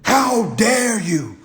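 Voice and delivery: high, slow mock-royal outrage; imperious king. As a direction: stretch it out like an affronted monarch. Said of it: "talk like a damn king".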